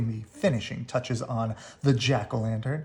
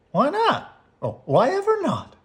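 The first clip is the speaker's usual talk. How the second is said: Mama voice; 14 semitones higher, 5 dB louder than usual. A narrator's reading. Mama asking the cubs.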